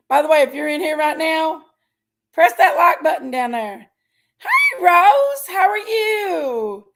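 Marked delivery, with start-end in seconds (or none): 4.4-6.8 high drawn-out hello, slow and stretched; sing-song greeting. Real, not acted. Greeting a viewer in live chat.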